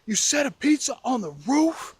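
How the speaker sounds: high-pitched incredulous outrage; acted fury, voice shooting up; furious. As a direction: imitate an outraged, disbelieving sibling. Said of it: "furious in about two seconds".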